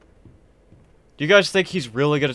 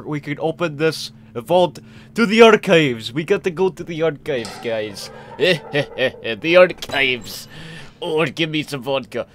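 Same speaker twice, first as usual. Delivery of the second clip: hammed-up Russian accent; weird Russian accent. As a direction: put on a playful, silly Russian accent. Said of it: "speaking in a weird Russian accent".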